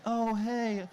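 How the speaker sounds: normal talking voice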